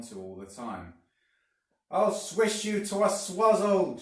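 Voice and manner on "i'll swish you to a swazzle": high, loud raging hag voice; furious shout. A narrator's reading. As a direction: scream threats while slashing with the stick. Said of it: "she shouted".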